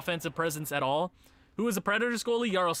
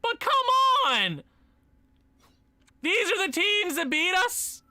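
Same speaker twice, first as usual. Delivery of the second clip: indignant outburst; 13 semitones higher, 5 dB louder than usual. Real, not acted. Indignant at who beat them.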